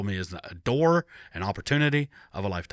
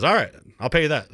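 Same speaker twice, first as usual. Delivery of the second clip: higher, louder and quick, a boss settling it; brisk boss voice; 7 semitones higher, 8 dB louder, faster than usual. Retold or imitated. Pastor matching the job offer, quick and loud. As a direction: toss off the deal fast and decisive.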